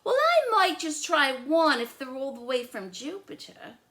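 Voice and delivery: high, loud stubborn list; picky girl listing foods she won't eat; fussy little sister. Acted. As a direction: rattle off everything she refuses. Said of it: "Lola deciding".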